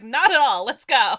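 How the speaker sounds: loud, punchy acted reply; imitating an upbeat, emphatic answer; eager reassurance. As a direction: call it out with gusto.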